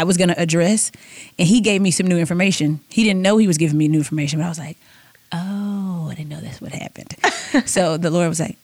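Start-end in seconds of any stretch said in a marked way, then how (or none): 5.3-7.1 hushed realization. Retold or imitated. Son reveals new information.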